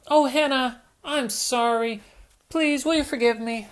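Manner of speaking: high, pleading little piglet; apologetic Peppa. Acted. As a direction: beg forgiveness, high and quick.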